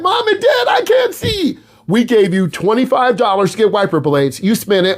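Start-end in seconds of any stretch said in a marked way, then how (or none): 0.0-1.6 very high, shouted panic; panicked kid in a storm. Pretend. Student driving in a heavy storm calling for parents, high panicked shout. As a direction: cry out to your parents, blind in the storm. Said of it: "you're like".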